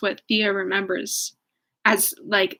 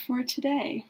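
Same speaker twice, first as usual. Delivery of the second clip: usual speaking voice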